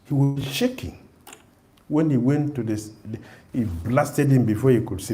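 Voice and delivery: ordinary talk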